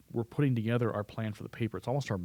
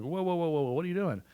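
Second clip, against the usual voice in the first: higher, alarmed whoas; 6 semitones higher than usual. Retold or imitated. A confused reader halting the writer, mock alarm. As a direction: stop them in mock alarm. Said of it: "people can be like".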